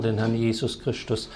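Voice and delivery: normal talking voice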